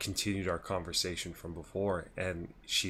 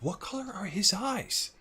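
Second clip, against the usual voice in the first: very high, slow, put-on question; imitating prying woman; 14 semitones higher, slower than usual. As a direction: mimic her drawn-out, squealed curiosity. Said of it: "she's like".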